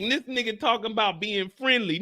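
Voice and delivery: ordinary delivery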